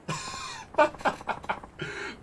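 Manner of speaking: breathless high laugh bursting out; high burst of laughter. Real, not acted. Mocking the self-proclaimed noble.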